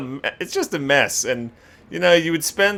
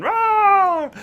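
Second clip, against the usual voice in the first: very high mock power-up yell; hammed-up battle cry; Super Saiyan roar; 3 dB louder than usual. Naming the Super Saiyan hair, hammed-up power-up roar.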